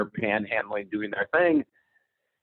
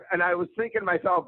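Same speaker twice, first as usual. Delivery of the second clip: ordinary talk, nothing marked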